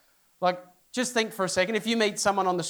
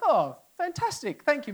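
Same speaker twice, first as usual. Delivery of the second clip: polite; 5 semitones higher than usual. Retold or imitated. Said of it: "I was polite".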